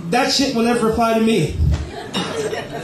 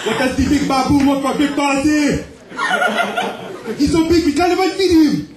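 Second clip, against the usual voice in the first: dad voice; 7 semitones higher than usual. Retold or imitated. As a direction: jeer like a laughing father. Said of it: "my dad would say".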